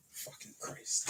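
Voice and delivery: breathy whispered fucking Christ under the breath; annoyed aside, whispered; whispered curse. Real, not acted. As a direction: curse softly to yourself. Muttered to himself after slipping.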